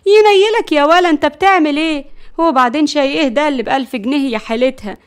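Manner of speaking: high, loud opening, then sarcastic questioning; exasperated grandmother's rebuke; indignant scolding. Acted. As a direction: tell the boy off in disbelief. Grandmother scolds the tea-selling boy.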